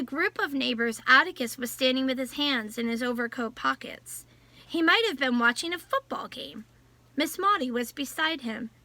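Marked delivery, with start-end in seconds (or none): none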